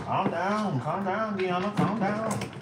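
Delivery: playful calming. Pretend. Soothing excited chat viewer.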